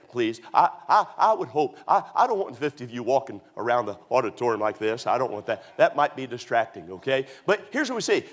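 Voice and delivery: ordinary talk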